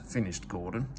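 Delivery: usual speaking voice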